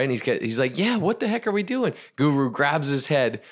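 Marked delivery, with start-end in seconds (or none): 0.8-1.9 high-pitched baffled protest; playing the exasperated student; exasperated disbelief. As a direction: protest in high, incredulous confusion. Student protests at chest-deep water.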